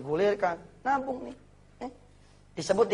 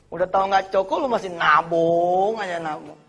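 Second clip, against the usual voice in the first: ordinary delivery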